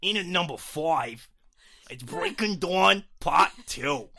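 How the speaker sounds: loud, high hammed-up New York accent. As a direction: belt it out as a cartoonish New York boy. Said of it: "shall I do a New York accent".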